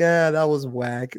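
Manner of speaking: slow, dragged-out mock dismissal; dismissive friend. Friend's dismissive voice, slow and unimpressed.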